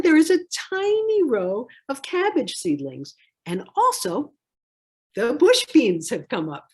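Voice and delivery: plain reading voice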